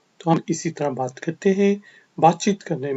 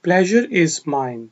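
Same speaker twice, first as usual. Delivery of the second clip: very slow careful read; slow teacher demo; slow model phrase; much slower than usual. Shown as a demonstration. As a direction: say it slowly in full. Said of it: "ये मेरे लिए खुशी की बात है".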